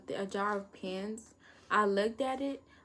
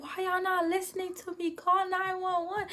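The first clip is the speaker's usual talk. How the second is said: much higher, pleading shout; desperate yell; 11 semitones higher than usual. Retold or imitated. Panicking student yells at the staff, high and desperate. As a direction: scream it like no one hears you. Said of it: "yelling at everybody".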